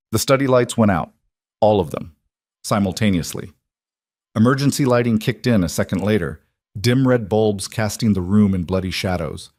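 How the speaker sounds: ordinary delivery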